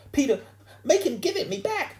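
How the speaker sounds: high, loud wail close to tears; upset schoolgirl. A narrator's reading. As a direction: appeal for help in a squealing girl's voice. She was ready to cry.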